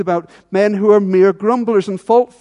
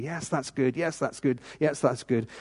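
soft smug murmur; 5 semitones lower, 10 dB quieter than usual. Pretend. Congregation nodding knowingly.

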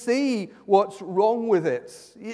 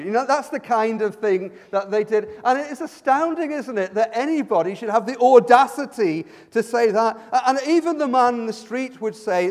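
ordinary delivery

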